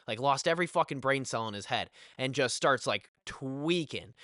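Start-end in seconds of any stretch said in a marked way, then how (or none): none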